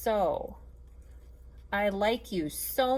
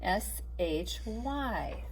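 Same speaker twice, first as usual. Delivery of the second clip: spelled slowly; 5 dB quieter, much slower than usual. Shown as a demonstration. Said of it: "you can write this down".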